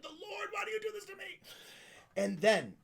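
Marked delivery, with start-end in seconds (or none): 0.0-1.4 much higher, hammed-up lament. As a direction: cry out to the heavens in mock grief. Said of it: "And I'm just like".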